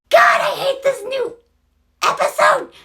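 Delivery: real frustration at the episode; exasperated outburst. Real, not acted. Venting about the show.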